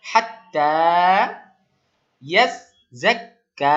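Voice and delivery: ইয়াজ্জাক্কা said slow and loud, the জ্জা and ক্কা held long; drawn-out recitation. Shown as a demonstration. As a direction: recite ইয়াজ্জাক্কা slowly, stretching each doubled letter. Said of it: "বলুন". (ইয়াজ্জাক্কা ধীরে টেনে জোরে উচ্চারণ)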